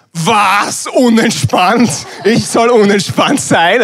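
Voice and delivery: high, loud, slow outraged echo of the word; mock-offended husband repeating his wife's jab in disbelief; indignant disbelief. Husband to wife Steffi, slow outraged disbelief.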